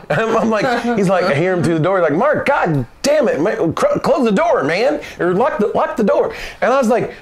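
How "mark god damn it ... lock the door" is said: high-pitched mock scolding; imitated exasperation. Boss complaining through the door, exasperated. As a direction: act out an appalled boss, pitched up.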